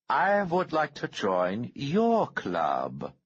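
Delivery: high, slow, cold menacing voice; the calm threat of a man holding a bomb trigger; menacing Harrington. A narrator's reading. Bomber threatening the senator's aide, slow and menacing.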